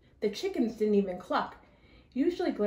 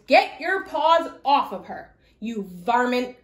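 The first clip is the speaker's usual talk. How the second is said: angry grandma; 5 dB louder than usual. Acted. Grandma scolding wolf.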